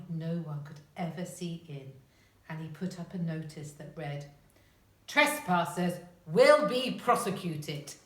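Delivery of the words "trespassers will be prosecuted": loud, slow proclamation in giant voice; giant's stern warning. A narrator's reading. Giant's notice on the garden wall, booming warning. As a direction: boom out each word of the warning.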